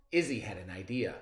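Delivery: plain reading voice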